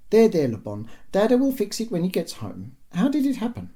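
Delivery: high-pitched comforting mum voice; soothing mother. A narrator's reading. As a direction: comfort a child in a lifted, sing-song mum voice. Mother soothing her upset son.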